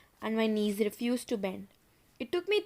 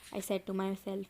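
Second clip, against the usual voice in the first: usual speaking voice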